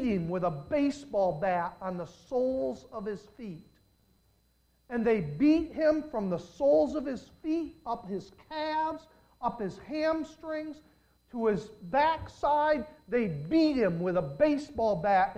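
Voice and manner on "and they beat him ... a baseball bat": high, slow, climbing preaching; rising preacher's cry. Acted. Pastor describing the soccer player's beating, preached high and slow. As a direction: build it blow by blow.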